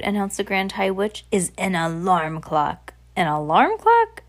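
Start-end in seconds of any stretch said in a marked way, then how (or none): 1.3-2.9 slow, deliberate reveal; announcing witch. Acted. 3.1-4.2 higher, louder incredulous echo; surprised question. Acted. Audience questions the secret.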